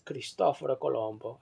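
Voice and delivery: Cristoforo Colombo in a tentative Italian accent; Italian pronunciation. Shown as a demonstration. Translating Christopher Columbus for listeners, attempted Italian pronunciation. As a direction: give the name an amateur Italian sound. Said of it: "how they say it".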